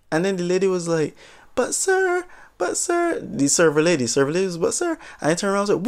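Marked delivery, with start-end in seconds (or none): 1.5-3.2 high, timid little voice; little soft voice. Retold or imitated. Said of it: "a little soft voice was happening". 4.6-5.0 high, timid little voice; little soft voice. Retold or imitated. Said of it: "a little soft voice was happening".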